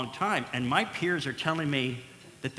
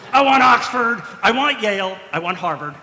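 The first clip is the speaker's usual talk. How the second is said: loud, laughing demand, fading down; 6 semitones higher, 11 dB louder than usual. Retold or imitated. Patient demanding a famous surgeon, loud. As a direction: insist on a top school. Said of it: "And I'm like".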